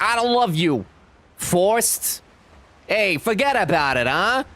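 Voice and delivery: hammed-up New York "forget about it"; New York accent. Jenny redone with a mock New York accent. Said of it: "she's from New York".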